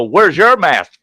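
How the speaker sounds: high, loud comeback; retold challenge. Resident catching manager without her mask, retold loud challenge.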